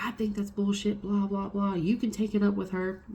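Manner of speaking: lightly acted outrage; mock indignation. Retold angry phone quarrel.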